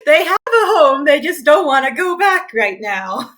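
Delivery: high, very loud indignant objection; loud protest. Real, not acted. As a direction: shout it down in defence of your character. Player protests the joke, laughing.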